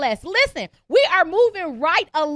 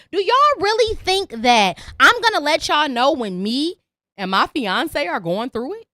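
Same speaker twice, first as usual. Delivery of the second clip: ordinary delivery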